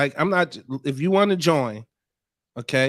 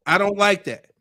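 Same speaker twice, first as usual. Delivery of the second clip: raised, firm retold objection; 4 semitones higher, 4 dB louder than usual. Man retelling a boyfriend's objection to his girlfriend, firmly. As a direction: quote him putting his foot down.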